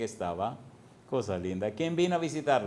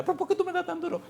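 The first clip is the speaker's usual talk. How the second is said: put-on very high, fast, whiny child's wail; crying boy; faster than usual. Preacher acting the skinny kid he beat, wailing high. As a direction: squeal it like a beaten little boy. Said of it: "empezó a llorar y a gritar".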